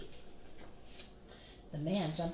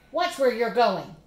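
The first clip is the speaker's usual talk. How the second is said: put-on loud angry yell at a boy; 3 semitones higher, 10 dB louder than usual. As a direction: bark it out at the careless kid. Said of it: "the man yelled".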